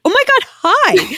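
high, squealing hello; excited greeting. Retold or imitated. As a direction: gush over spotting someone you know. Greeting therapist in restaurant.

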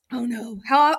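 usual speaking voice

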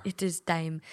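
slipping into a Minnesota accent. Real, not acted. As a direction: Minnesota vowels on "it is time". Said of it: "My Minnesota accent came out there".

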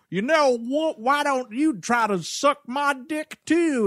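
high, hammed-up Southern drawl; mock a terrible Southern accent, pitched high; overcooked Southern twang, imitating an actress; bad Southern accent. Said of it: "trying to do this Southern accent".

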